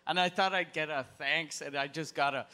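ordinary talk

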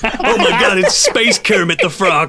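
talking through laughter; giggling as you speak; laughing. Real, not acted. Players laughing at the voice.

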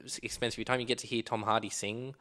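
ordinary talk, nothing marked

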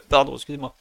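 sudden loud cough mid-sentence; coughing after swallowing wrong; coughing fit. Real, not acted. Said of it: "j'ai avalé un truc de travers".

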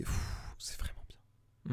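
slow, voiceless, hushed praise; awed whisper. Real, not acted. Fan praising a comedy show, awed whisper. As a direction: whisper it slowly, full of admiration.

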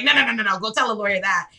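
ordinary talk

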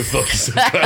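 laughing imitation of a Salford dad; dad's Manc accent, cracking up; Manchester accent. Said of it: "what my dad says to me".

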